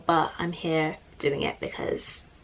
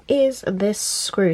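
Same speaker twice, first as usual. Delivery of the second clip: ordinary talk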